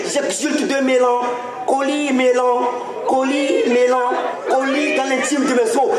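mélancolie drawn out slowly, repeated; slow repeated stress. Acted. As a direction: linger on each mélancolie slowly. Slam poet repeats word slowly.

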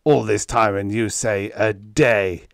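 indignant, voiced hiss; incredulous, wounded reproach; angry hiss. A narrator's reading. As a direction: throw it back, offended. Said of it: "Lauren hissed".

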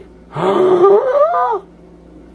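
loud, high, drawn-out gasp; sharp shocked gasp. Real, not acted. Player hit by a game shock, loud high gasp.

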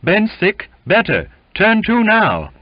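high, loud, broken phrases; excited, emphatic outburst; eager insistence. A narrator's reading. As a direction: fire off eager short assurances. Eager sick man pleads fitness.

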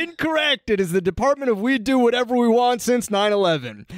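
punchy mock-dramatic episode summary; comic recap narration. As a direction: read the recap with showy, stretched emphasis.

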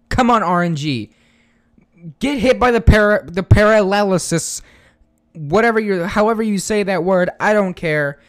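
loud, high eager urging; excited pleading. Real, not acted. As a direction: cheer the luck on loudly. Pleading for paralysis mid-battle.

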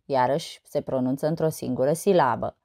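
normal talking voice

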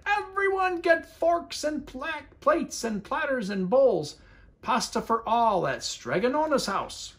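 high, calling out to everyone; shouting to the town. A narrator's reading. Big Anthony calls the town to the pasta, shouting. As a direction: call the whole town to dinner. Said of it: "and shouted".

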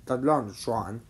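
ordinary delivery

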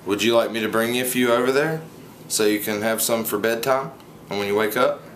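normal talking voice

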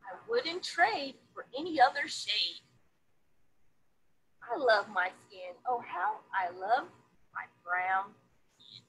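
lively picture-book read-aloud; reading to children. A narrator's reading. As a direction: read it to a classroom of kids, bright and sing-song.

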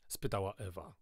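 ordinary delivery, nothing marked

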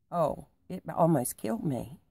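usual speaking voice

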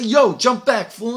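sharp, loud, high rebuff; loud brush-off. Retold or imitated. Helen yelling back at the flirt, loud. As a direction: shove him off with your voice.

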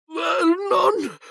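very high, panicked call; piercing cry of terror; horrified shriek. A narrator's reading. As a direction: shriek for your husband. Wife reads the letter, horrified.

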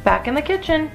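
ordinary delivery, nothing marked

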